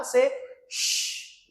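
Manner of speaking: whispered sh; breathy sh demo; the sh sound. Shown as a demonstration. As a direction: say "Se sh" with a hushed sh. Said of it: "Kòman pwononse se H lan la".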